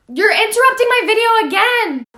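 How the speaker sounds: loud, high, exasperated yell; annoyed shout. Real, not acted. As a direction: yell at the intruder for real. Yelling at someone interrupting.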